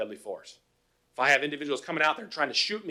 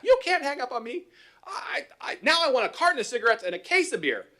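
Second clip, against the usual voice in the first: mock angry caller; 10 semitones higher than usual. Angry caller demands cigarettes.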